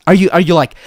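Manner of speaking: usual speaking voice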